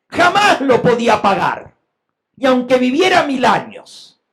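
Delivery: high-pitched, forceful pulpit delivery, building; impassioned preaching. Real, not acted. Preacher shows the congregation an impossible debt, fervent preaching. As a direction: drive the huge sum home at full voice.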